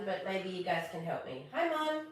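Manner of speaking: usual speaking voice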